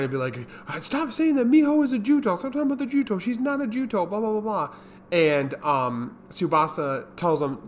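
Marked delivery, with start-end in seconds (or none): 0.7-4.7 high, strained, pleading protest; frantic denial. Retold or imitated. Kijino insisting to his brothers she is no monster, high frantic voice. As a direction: squeak out a desperate denial, louder and higher. Kijino denying Miho is Juto.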